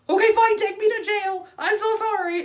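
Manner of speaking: very high, loud, mock-resigned old lady; giving-up Grammy voice. Imitating Grammy surrendering to the officers, high and loud. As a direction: wail it like a tiny grandma giving herself up.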